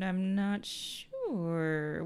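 very slow, drawn-out uncertainty; evasive stalling. Acted. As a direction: stretch the words out to stall. Character stalling when questioned.

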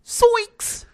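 high cracking yelp; Shaggy voice. Retold or imitated. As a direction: squeal it like a scared hippie. Shaggy catchphrase shouted.

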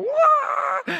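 very high, hammed-up excited wail; mock shriek of wild excitement; hyper squeal. Preacher imitating hyper church people, high-pitched squeal. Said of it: "all these hyper people".

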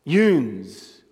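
"Yoons" said as "yoonz", Baltimore style. Shown as a demonstration. Preacher showing the Baltimore word to the congregation, local pronunciation. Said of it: "They say yoons in Baltimore".

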